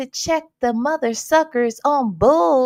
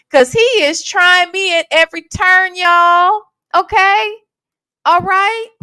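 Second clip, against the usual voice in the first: fired-up defiance; 5 semitones higher, 5 dB louder than usual. Real, not acted. Defiant rant at the devil.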